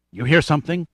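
usual speaking voice

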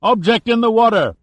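high, loud call from the bow; lookout's yell. A narrator's reading. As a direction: yell out the sighting like a ship's lookout. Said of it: "a lookout on the bow yelled out".

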